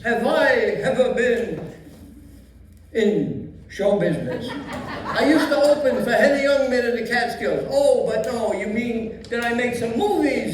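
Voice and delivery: put-on old Yiddish grandpa accent; Yiddish old man voice.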